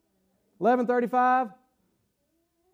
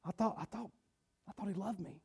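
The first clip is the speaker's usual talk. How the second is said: much softer, hurt murmur; 3 semitones lower, 19 dB quieter than usual. Retold or imitated. Mary and Martha questioning Jesus, softly and hurt. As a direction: let the hurt almost fall away. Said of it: "where Mary and Martha were at".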